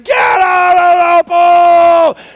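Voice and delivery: loud, slow, high yell of "Get out of the pool!". Retold or imitated. Lifeguard ordering swimmers out of the pool, full yell. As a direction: bellow the order across the pool.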